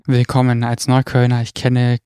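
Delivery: normal talking voice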